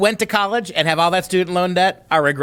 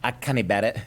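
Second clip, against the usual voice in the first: put-on Scottish accent, pitched lower and a little softer; dubious Scottish impression for a comic aside; mock Scottish accent; 6 semitones lower, 5 dB quieter, faster than usual. Quoting Scottish friends after the clip, in a mock Scottish accent. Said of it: "in the words of my Scottish friends".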